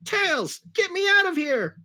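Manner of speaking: a hammed-up plea to be rescued; scared cry. A narrator's reading. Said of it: "cried Sonic in a way that sounded so scared".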